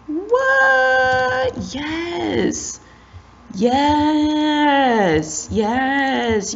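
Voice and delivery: high, drawn-out cheering; thrilled disbelief. Real, not acted. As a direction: stretch each word in high delight. Thrilled at getting the trimmed cape.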